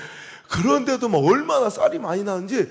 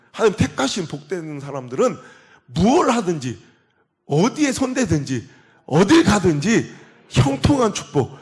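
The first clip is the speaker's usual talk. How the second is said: preaching blessing; 6 dB louder than usual. Acted. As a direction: pronounce the blessing over the congregation.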